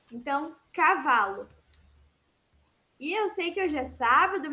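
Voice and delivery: ordinary talk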